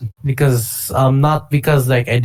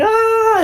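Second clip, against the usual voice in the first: high, contained "ah" scream; mock scream. Retelling screaming through a Tekken tournament, high mock scream. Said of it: "Tekken screaming".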